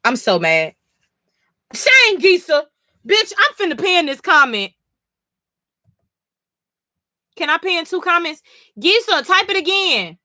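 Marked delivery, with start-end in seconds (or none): 1.7-10.2 excited call-out. Real, not acted. Streamer calling Gisa in chat to repost her comment, excited high voice. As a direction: pitch it up and hype the viewer.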